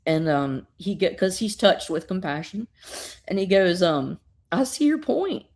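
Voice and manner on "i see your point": put-on higher, rising first try of the old man's line; the storyteller's first go at the father's reply, pitched up and rising. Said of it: "no, no, wait".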